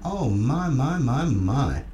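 slow, falling oh my my my; mock disbelief. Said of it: "I'm just going".

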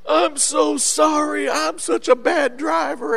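high, slow mock-crying apology; imitated tearful sobbing. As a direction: wail it like a driver in tears. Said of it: "she burst into tears and hugs me".

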